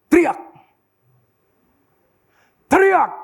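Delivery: sudden high, loud cry; imitated shout. Preacher imitates a cry heard at two a.m., shouting. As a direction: imitate a shout ringing out in the night. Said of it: "saya dengar terang sekali suara teriak begini".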